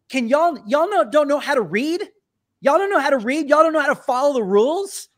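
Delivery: high, loud mock outrage; indignant scolding. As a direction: imitate an indignant customer scolding. Acted scolding from woman in line.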